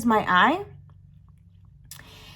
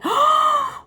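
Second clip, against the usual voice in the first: loud, high drawn gasp; big horrified intake of breath; shocked gasp; 5 dB louder than usual. Acted. Teller looking over at her friend, horrified gasp.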